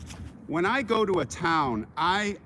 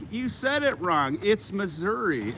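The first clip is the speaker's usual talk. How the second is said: slow, higher correcting voice; mocking commenter; 4 semitones higher than usual. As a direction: mimic a know-it-all commenter. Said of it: "they're like".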